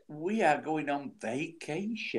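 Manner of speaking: slow, over-careful "vacation"; mock American wording. Correcting friends for Gail over there, "vacation" said slowly and pointedly. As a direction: spell out "vacation" slowly and deliberately. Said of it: "we've gotta say vacation now".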